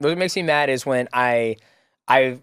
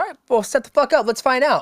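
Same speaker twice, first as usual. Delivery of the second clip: fired-up challenge; 11 semitones higher than usual. Retold or imitated. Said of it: "I was like".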